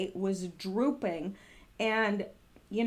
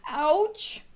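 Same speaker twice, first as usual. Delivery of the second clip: ouch; 7 semitones higher, 6 dB louder than usual. Real, not acted. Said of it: "I just wanna say something".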